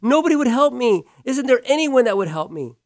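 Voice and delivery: high, pleading, near tears of frustration; exasperated plea. Retold or imitated. Stranded woman asks if anyone will help, high exasperated plea. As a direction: cry out that nobody will help. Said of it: "And then she goes".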